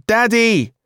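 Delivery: put-on very high, loud toddler call; small child crying out. A narrator's reading. Emma calls Dad from downstairs, child's cry. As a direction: cry out for a parent far off. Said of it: "cried Emma from downstairs".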